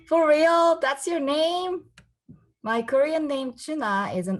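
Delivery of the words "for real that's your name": mocking classmate. Classmate mocks her name.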